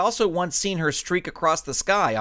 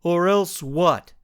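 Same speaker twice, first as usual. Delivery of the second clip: slow, louder lumbering brute voice; 4 semitones higher, 4 dB louder, slower than usual. Acted. Living Lump daring the superhero, slow heavy brute. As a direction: push back, heavy and slow. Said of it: "but now spoke".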